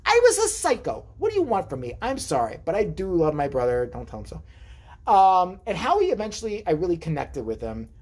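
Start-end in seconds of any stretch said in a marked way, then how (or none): 3.9-5.1 slow whispered aside; secret aside. Real, not acted. Comic telling the crowd a secret, whispered. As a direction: let the audience in on a secret, slowly.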